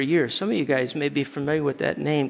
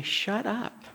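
hushed, raised-pitch command; inner rebuke; 4 semitones higher, 10 dB quieter than usual. Retold or imitated. The Holy Spirit silencing the driver, hushed rebuke. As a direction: say it very softly, as a voice within. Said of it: "the Holy Spirit just told me".

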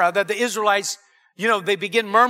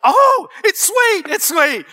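high, slow, louder cry of wonder; amazed delight; 8 semitones higher, 5 dB louder, slower than usual. Retold or imitated. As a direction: taste it and marvel as Moses. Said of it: "I wonder if it was Moses".

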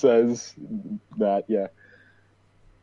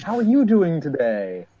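cosying-up charmer; 8 semitones higher than usual. Acted. Pepper nestles in close to greet the old lady, cosying-up charmer. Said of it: "He, like, nestles in real close".

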